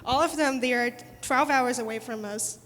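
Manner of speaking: ordinary talk, nothing marked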